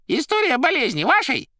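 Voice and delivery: high squeaky loud query; Koroviev. A narrator's reading. Koroviev showing the Master his file, high squeak. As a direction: ask in a very high character voice. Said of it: "Коровьев швырнул".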